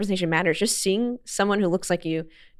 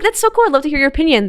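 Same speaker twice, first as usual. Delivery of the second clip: much higher, louder fake-innocent voice; playing dumb; 9 semitones higher, 8 dB louder than usual. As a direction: act sweetly clueless to disarm a troll. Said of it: "I just play a little dumb".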